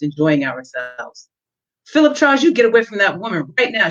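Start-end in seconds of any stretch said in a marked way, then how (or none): 1.9-3.9 higher, louder and fast, jealous scolding; furious demand. A narrator's reading. Jealous partner orders Philip away from the girl, furious and higher. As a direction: order him away in a rising fury. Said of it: "she demanded, growing more furious".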